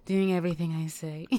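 ordinary talk

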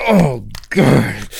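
ordinary talk

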